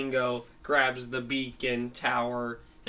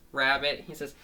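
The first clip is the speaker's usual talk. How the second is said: ordinary delivery, nothing marked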